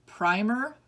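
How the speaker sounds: normal talking voice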